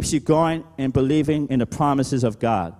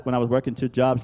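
ordinary delivery

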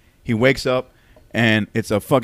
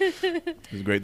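squeaky laugh; 5 dB quieter, slower than usual. Real, not acted. On massager, laughing.

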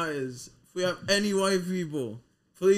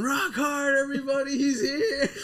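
mock rock-star shout; 11 semitones higher than usual. Coworkers greeting him, mocked.

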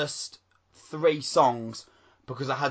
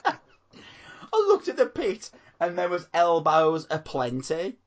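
laughing; 5 semitones higher, 3 dB louder, slower than usual. Real, not acted. Laughing while telling.

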